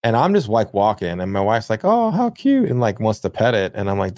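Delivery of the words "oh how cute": high, sweet cooing at a dog; cooing wife. Retold or imitated. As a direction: coo at the cute dog, pitched way up.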